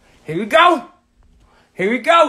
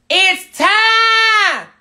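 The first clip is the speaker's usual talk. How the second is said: drawn-out ring announcer call, higher and louder; hype announcer shout; 8 semitones higher, 9 dB louder, much slower than usual. Acted. Host kicking off his livestream to viewers, a drawn-out hype shout. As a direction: bellow it like the show is starting.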